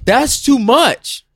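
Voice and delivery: high, slow incredulous protest; exasperated disbelief. Real, not acted. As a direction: stretch it out in disbelief. Reacting to cat's many names.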